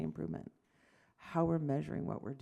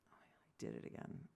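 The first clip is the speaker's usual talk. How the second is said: a quick, much lower whispered aside; 12 dB quieter, faster than usual. Real, not acted. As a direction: whisper fast, half to yourself. Embarrassed aside.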